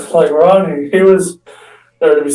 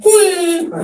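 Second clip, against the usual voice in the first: squealed high whee. Retold or imitated. Prankster squealing as the air horn goes off. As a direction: let out a gleeful squeal.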